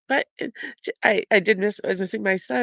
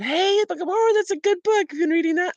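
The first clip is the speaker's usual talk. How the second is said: much higher, bright mock-cheerful guest voice; chirpy visitor voice; 12 semitones higher, 4 dB louder than usual. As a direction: play the friend spotting the book, pitched way up. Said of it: "and they said".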